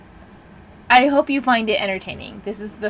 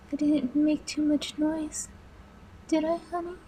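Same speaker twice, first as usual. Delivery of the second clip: small-animal wife; 8 semitones higher, 5 dB quieter than usual. Acted. Villain mimics captive's wife before the asylum, meek and high. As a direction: mimic a frightened little wife, softly pitched up. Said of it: "like a small animal, she said".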